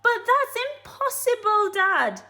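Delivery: small monkey protests to his father; small monkey protesting. Acted. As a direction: whine it at Dad. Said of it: "cried one of the small monkeys".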